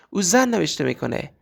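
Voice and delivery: usual speaking voice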